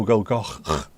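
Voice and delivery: "go, Goch" with a guttural ch; Welsh ch. Shown as a demonstration. Streamer repeating the sound for chat. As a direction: scrape the final ch of "Goch". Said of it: "followed by go".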